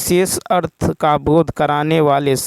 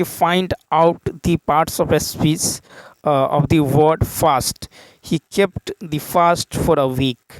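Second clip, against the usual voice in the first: slow, drawn-out reading of an English exam question; deliberate dictation pace; much slower than usual. Acted. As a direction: read the question word by word, very slowly. Reads English question slowly.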